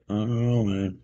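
ordinary talk, nothing marked

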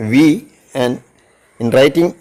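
normal talking voice

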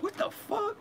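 retold shocked yelp. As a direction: caught totally off guard. Spotting heads in the wall.

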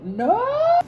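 high shocked squeal; a sudden squeak of shock; surprised squeal. Real, not acted. Opening box two, a shocked high squeal.

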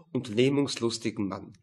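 ordinary delivery

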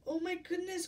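high storybook cry of alarm. A narrator's reading. Giantess hears the giant coming, alarmed cry. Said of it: "cried the giantess".

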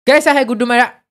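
ordinary talk

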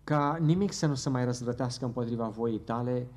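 normal talking voice

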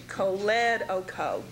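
choledoco as koh-LED-oh-koh; shifted stress. Shown as a demonstration. Teacher telling students to join the parts, choledoco stressed on LED. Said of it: "pronouncing it".

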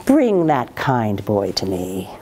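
low, slow royal order; emperor's command. A narrator's reading. Emperor ordering guards to bring Ma Lin, low and slow.

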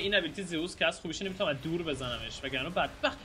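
normal talking voice

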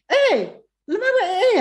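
loud, very high-pitched shout; alarmed mother calling out. Retold or imitated. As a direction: imitate mom bursting in with a yell. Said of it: "running into my room".

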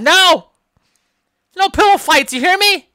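high, loud panicked yell; yelling no pillow fights. Acted. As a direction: shout him down, alarmed and high. Yelling at friend over pillow fight.

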